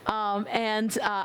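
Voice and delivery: normal talking voice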